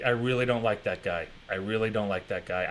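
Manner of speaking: low, soft muttered dislike; low and quiet. Real, not acted. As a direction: say it under your breath.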